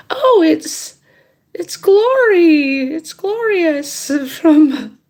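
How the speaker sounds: high, drawn-out mock caller voice; singsong-high imitation of a woman bluffing on the phone; imitated impostor caller.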